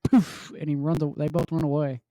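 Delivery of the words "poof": mock poof sound. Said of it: "he's like".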